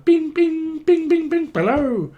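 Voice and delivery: high rapid bings, squeaky hello; piercing comic ringtone imitation; mock phone ringing. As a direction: ring like a phone then answer. Imitates ringing, then answering.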